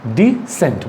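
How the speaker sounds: डिसेंट with a light d, then "sent"; डिसेंट, a light di then sent, the teacher's model; model pronunciation. Shown as a demonstration. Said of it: "इसको हम क्या बोलेंगे?".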